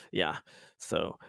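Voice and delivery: normal talking voice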